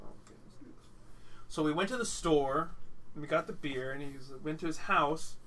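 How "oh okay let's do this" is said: whispered. Retold or imitated. Drunk friend suggests more beer.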